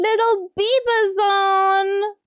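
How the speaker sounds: gasp, then high, drawn-out squeal; slow, high cry of delight; excited call-out. Real, not acted. Streamer spotting a dear friend's message, excited squeal.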